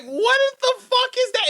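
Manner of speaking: incredulous reaction. Retold or imitated. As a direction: sound floored by what you just saw. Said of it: "And I'm like".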